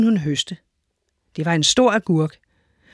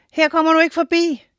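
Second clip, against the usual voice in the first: much higher, defiant storybook voice; voice of the sea; 9 semitones higher than usual. A narrator's reading. The sea stopping the giant, high defiant voice. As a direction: stand firm against the giant, pitched high. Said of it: "sagde havet".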